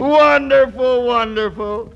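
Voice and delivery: high loud delighted wonderful. Acted. Boss gushing at the new girl's joke. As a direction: gush over her wit.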